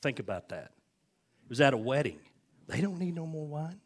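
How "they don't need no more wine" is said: high-pitched put-on protest; higher voice, playfully objecting; mock objection. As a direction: pipe up like a disapproving onlooker. Mimics objectors to more wedding wine.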